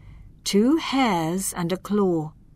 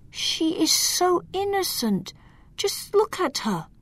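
tender pleading; 11 semitones higher than usual. Acted. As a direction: coax him gently, higher up. Hector shows portrait.

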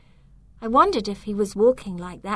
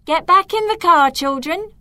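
loud, high call across the distance; 8 semitones higher, 8 dB louder than usual. Acted. Mum calling the children back to the car from afar, loud. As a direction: shout it over to the kids on the bank.

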